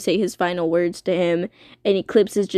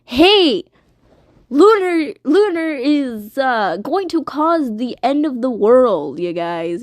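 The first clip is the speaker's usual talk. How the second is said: higher, louder alarmed warning; Eclipse warning everyone; 3 semitones higher, 4 dB louder than usual. Retold or imitated. Eclipse warns the others about Lunar, in a raised alarmed voice. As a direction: urgently warn everyone. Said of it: "tell everyone that".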